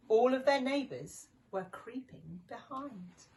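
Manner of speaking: ordinary delivery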